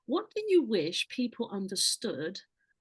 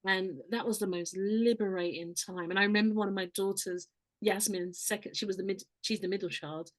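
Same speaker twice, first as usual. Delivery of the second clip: usual speaking voice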